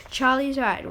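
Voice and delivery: usual speaking voice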